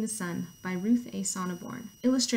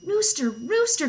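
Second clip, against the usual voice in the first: high, loud, drawn-out call; storybook hen raising the alarm; crying out; 7 semitones higher, 6 dB louder, slower than usual. Acted. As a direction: call urgently to another farm animal. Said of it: "cried Hen".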